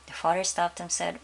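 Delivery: usual speaking voice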